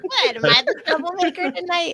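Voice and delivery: high-pitched, laughing protest; playful mock surprise. Real, not acted. Panelist teased by host, playful mock surprise. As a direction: squeal it in feigned innocence.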